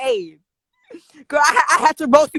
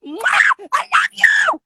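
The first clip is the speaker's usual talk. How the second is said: very high, loud squeal; over-the-top gushing; 5 dB louder, much slower than usual. Pretend. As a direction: blow a kiss and shriek it sky-high. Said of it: "if you sound like that".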